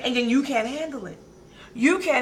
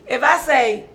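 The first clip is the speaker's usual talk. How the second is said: usual speaking voice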